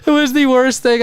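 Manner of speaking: real laughter, barely holding it together; laughing hard. Real, not acted. Laughs about keeping the secret.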